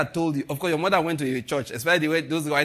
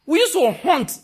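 higher and louder, excited; excited exclaim; 11 semitones higher, 8 dB louder, slower than usual. Real, not acted. Pastor recalling campus witch hunts, excited exclaim. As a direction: burst out with it.

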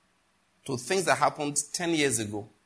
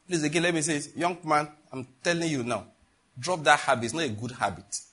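ordinary talk, nothing marked

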